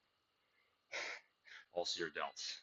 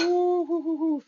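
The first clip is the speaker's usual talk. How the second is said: high, loud ooh at the burn; high ooh of effort; 11 dB louder than usual. Real, not acted. Feeling the muscle burn.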